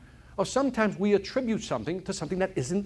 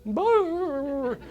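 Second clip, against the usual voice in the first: much higher rolling mock growl; dog growl; 11 semitones higher than usual. Dog lying on a tack, growling, high rolling brr. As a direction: let the hound whine out a rolling brr. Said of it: "What do you hear in the background".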